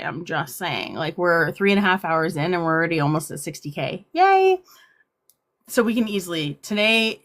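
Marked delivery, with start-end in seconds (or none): 4.1-4.6 excited yay. Real, not acted. Cheering the likes count.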